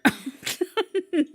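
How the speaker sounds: short high burst of laughter. Real, not acted. Reader laughs at her own impression, high laughter.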